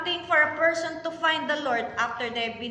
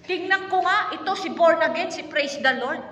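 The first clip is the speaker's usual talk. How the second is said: mocking neighbour; 5 semitones higher, 6 dB louder than usual. Neighbours mocking the born-again.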